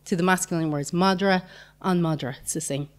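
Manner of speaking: normal talking voice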